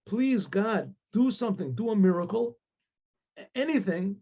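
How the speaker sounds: high-pitched, climbing begging prayer; tearful desperate plea. Acted. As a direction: plead with God, pitch rising as tears threaten. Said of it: "he starts crying".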